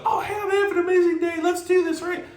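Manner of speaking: a client bursting with enthusiasm; hyped-up client. Retold or imitated. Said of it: "if they're the person that's like".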